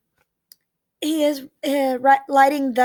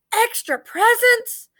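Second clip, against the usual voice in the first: high, slow, drawn-out question; wide-eared child's lifted echo; thrilled disbelief; 9 semitones higher, slower than usual. A narrator's reading. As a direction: savour it in excited amazement. Child thrilled about presents.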